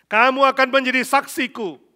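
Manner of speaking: loud, high proclamation of scripture; full-force preaching. Real, not acted. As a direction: proclaim it to the whole congregation. (khotbah lantang, menyerukan firman)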